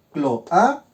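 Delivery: "Cloaca" broken as clo, a, ca; teacher spacing out "Cloaca" piece by piece; split into syllables. Shown as a demonstration. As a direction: separate the two vowels in "Cloaca" with pauses. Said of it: "Hago mi separación".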